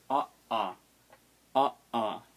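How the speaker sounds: "ah, ah" split by a sharp catch; glottal stop. Shown as a demonstration. Teacher saying the word for crow, "ah, ah" with glottal stop. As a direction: pause in the throat between "ah" and "ah". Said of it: "that means you pause".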